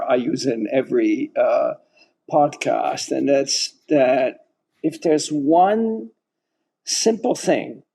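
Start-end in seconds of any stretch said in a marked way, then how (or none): 5.3-7.8 SIMPLE set off by a pause, slow and higher; stress on SIMPLE. Real, not acted. Host asking guests for one easy step, stress on SIMPLE. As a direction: draw out "simple" after a pause. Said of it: "emphasis on simple".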